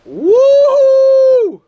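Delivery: high, loud celebratory whoop. Real, not acted.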